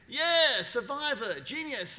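quick bright burst, voice leaping up loud on yeah; excited recognition. Retold or imitated. Trump picking up the phone, thrilled to hear from the Survivor producer, excited. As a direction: greet him like a big fan.

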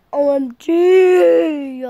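slow, loud O M G; shocked. Acted. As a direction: draw out the shock. Shocked character.

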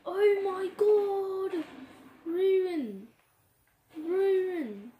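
loud, high wailing cry for Ruin; hammed-up sobbing shout, slow on oh my god; imitating a panicked government crying out; mock crying government. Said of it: "The government said".

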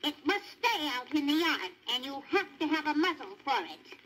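lightly voiced fussy old aunt; stern Aunt Sarah. A narrator's reading. Aunt Sarah orders the dog outside, lightly voiced and stern. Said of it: "she said".

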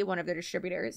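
ordinary talk, nothing marked

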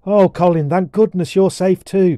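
put-on high, loud girl's exclamation; relieved cry. A narrator's reading. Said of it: "cried Susan".

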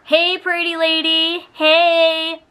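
slow, lightly voiced creepy call through the door; imitating creepy voice. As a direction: lure her out like a creep, slowly. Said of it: "I heard".

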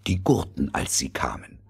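ordinary delivery, nothing marked

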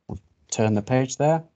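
usual speaking voice

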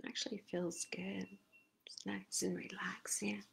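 soft contented whisper; relaxed whisper. Real, not acted. Teacher whispers to the class mid-massage, relaxed. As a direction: breathe it out, relaxed.

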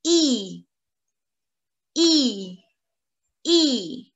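I said as a long "ee"; French I sound. Shown as a demonstration. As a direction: hold a clear, isolated "ee" for the French I. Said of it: "sound of the letter I is E".

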